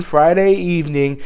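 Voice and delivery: ordinary delivery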